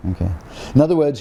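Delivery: usual speaking voice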